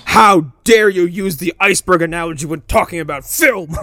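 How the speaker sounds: shouted, hammed-up indignation; mock outrage. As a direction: bellow it like an offended critic. Mock outrage at film takes.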